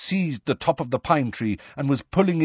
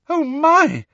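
high frightened little squeal; terrified squeal; 6 dB louder than usual. A narrator's reading. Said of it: "Hermione squeal, terrified".

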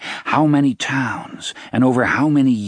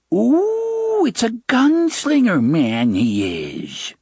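put-on old witch voice; 13 semitones higher, slower than usual. Sister taunts the gunslinger.

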